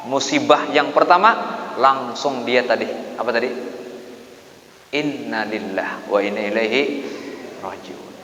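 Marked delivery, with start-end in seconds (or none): none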